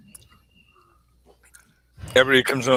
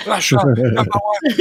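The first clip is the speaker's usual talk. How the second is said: slow Iron Sheik chant, "Russia number one"; Iron Sheik accent; 4 semitones higher, 3 dB louder, slower than usual. Retold or imitated. Host mimicking the Iron Sheik's slow chant in his Iranian accent. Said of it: "saying in his accent".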